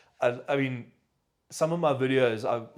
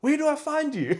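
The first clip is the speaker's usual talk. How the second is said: high, louder eager question; imitated mom; 6 dB louder than usual. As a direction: play the keen mom, voice pitched up. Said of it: "She's like".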